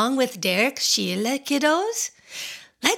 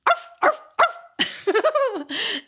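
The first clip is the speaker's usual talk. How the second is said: high, playful puppy yaps; high yappy arfs for the kids; doggy barks; 12 semitones higher, much slower than usual. Pretend. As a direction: bark like a happy little dog. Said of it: "That's how doggies talk".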